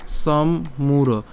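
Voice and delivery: "সম্মূঢ়" very slowly, split as som-muro; teacher sounding out "সম্মূঢ়" in two parts; broken-down pronunciation. Shown as a demonstration. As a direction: close on m, pause, then muro in "সম্মূঢ়". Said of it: "যদি আমি লিখে দেখাই".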